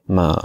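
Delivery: "ma" drawn out with a low dip and rise; "ma" as a Mandarin third tone, falling then rising. Shown as a demonstration. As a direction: drop "ma" low, then lift it. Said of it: "Et vous faites le mouvement avec la tête".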